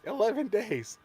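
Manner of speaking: high squeaky kobold yap; squeaky yappy lizard chatter. Acted. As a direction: chirp it like a tiny lizard. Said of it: "my kobold voice".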